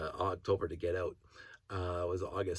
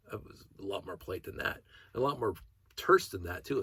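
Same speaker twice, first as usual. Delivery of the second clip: ordinary delivery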